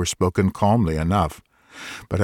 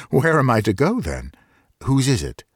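higher, half-laughing disbelief; incredulous; 6 semitones higher than usual. A narrator's reading. Doctor repeats the stranger's offer in laughing disbelief. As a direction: toss the words back, amazed.

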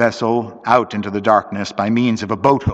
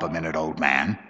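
lowered, softer aside; low voice; 7 semitones lower, 8 dB quieter than usual. A narrator's reading. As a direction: keep it down, low in pitch. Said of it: "he said in a low voice".

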